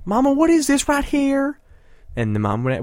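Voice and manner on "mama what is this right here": high squeaky mock child voice; mimicking the kid's question. Host mocking a kid asking mama, high pitched. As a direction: parrot the interviewee as a little kid, much higher.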